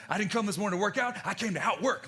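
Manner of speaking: fast, pumped-up coach slogan; hyped spin coach. Retold or imitated. Peloton coach hyping riders. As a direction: shout it like a fitness instructor firing up the class. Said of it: "Alex Toussaint or some of those other Peloton".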